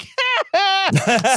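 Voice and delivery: high-pitched mock cackle; gloating laugh. Host mocking the man who lost money, gloating laugh. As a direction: laugh high and gleefully at his misfortune.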